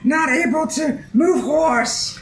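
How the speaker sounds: high, slow, shouting goblin voice; a little goblin yelling in frustration; frustrated goblin. Acted. Goblin Twix shouting because he cannot move the horse, high and slow.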